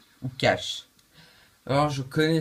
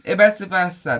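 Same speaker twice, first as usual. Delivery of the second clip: loud wrong-answer call; 2 semitones higher, 8 dB louder than usual. Acted. Host tells contestant wrong answer.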